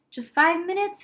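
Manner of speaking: lightly voiced boy asking nicely; pleading. A narrator's reading. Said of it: "Harry pleaded".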